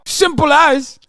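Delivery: high, loud British catchphrase; mock British geezer. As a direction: cap it off in a cartoon British accent. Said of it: "the British authorities be like".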